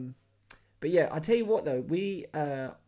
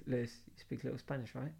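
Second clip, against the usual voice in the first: hushed; 2 semitones lower, 10 dB quieter than usual. Retold or imitated. Whispering at the bar.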